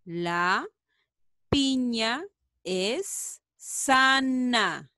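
very slow, even words; very slow again. Shown as a demonstration. Teacher repeats the sentence very slowly. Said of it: "A ver".

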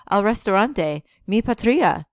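loud, high, grandly declaimed Spanish; dramatic patriot. Acted. Bren hams up a patriotic cry to his friends, loud and grand. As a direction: proclaim it like a rallying cry. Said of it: "Don't start talking like that again".

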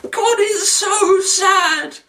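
very high, drawn-out hammed-up wail; mock sobbing. Reviewer begging sad Eeyore not to cry, mock sobbing. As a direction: blubber through it in a squeaky, slow voice.

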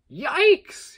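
high, loud startled yelp. Acted. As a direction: jump back from the smoke with a squeal. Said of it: "he yelped".

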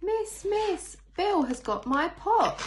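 put-on high, loud, slow tattling child voice; telling tales. Teacher reading a picture book, voicing a tattling child. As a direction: whine it to the teacher like a telltale.

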